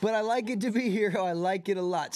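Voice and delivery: 1 gleeful cat. Acted.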